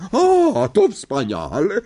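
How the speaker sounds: high, loud, gleeful exclamation; delighted. A narrator's reading. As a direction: gloat with delight. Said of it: "ucieszyła się ciotka Merch". (radosny, wysoki okrzyk)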